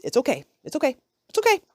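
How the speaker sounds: a sing-song climb up to a squeak; rising reassurance. Shown as a demonstration. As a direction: lift it further each time till squeaky. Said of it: "I said is".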